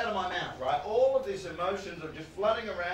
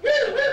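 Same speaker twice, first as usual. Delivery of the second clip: high loud hooting woo; mock test noise; 10 dB louder than usual. Comic mocking the audiologist's test noises, high hoot. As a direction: hoot like a kazoo through the wall. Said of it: "He's not just outside like".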